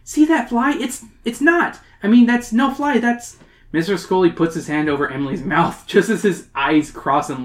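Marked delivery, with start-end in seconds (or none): 0.0-3.4 high, urgent, halting explanation; pleading. Acted. Emily pointing out the fly to Scully, pleading. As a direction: stumble over it, pitched way up. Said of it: "Emily pleads".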